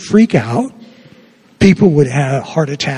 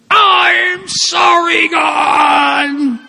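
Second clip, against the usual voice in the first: higher, slow, drawn-out scream; screaming louder; much slower than usual. Retold or imitated. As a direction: yell it as loud as a class can. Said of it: "I have them scream even louder".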